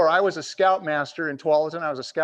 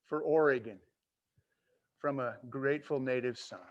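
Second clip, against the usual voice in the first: ordinary talk, nothing marked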